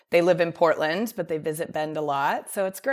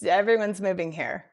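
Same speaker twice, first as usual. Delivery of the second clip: ordinary delivery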